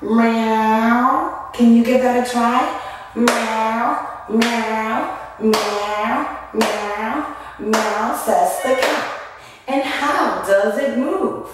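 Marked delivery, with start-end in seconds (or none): 0.0-1.5 long drawn-out meow; stretched cat imitation. Shown as a demonstration. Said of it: "The cat might sound meow". 3.1-8.2 repeated meows on a beat; rhythmic cat imitation. Shown as a demonstration. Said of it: "The cat might sound meow".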